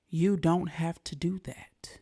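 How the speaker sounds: slow, hushed reassurance; gentle whisper. Real, not acted. Reassuring young viewers they need not conform, slow soft whisper.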